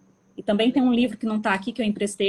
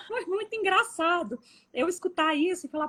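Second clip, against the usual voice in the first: high, talking through laughter; words bubbling with real laughter; laughing; 9 semitones higher than usual. Real, not acted. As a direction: still laughing as you speak.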